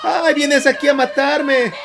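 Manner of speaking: high-pitched mock wail of a two-year-old; toddler tantrum. Interpreter mimicking a child screaming at God, high tantrum voice. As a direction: whine in a high voice like a protesting small child. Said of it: "gritar como un niño de dos años".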